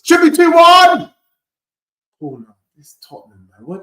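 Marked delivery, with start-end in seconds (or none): 0.0-1.1 loud, high, slow shout at the screen; real fan outburst; frustrated shout. Real, not acted. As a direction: yell the chance that got away. Near miss while watching live.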